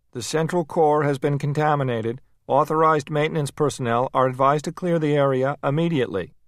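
high, loud automated warning; a machine voice broadcasting an alarm; recorded announcement. A narrator's reading. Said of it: "A new recorded voice broke in".

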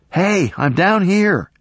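slow, loud, high call; calling back. A narrator's reading. Sarah calling back to the voice, loud and slow. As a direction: call out so they can hear you. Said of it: "She called back".

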